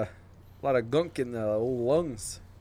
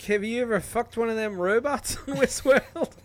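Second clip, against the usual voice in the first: put-on Australian accent; 7 semitones higher than usual. Podcast host brags to his co-host in a hammed-up Australian accent. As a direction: ham up an Australian accent through the laugh. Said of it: "both of your Australian accents".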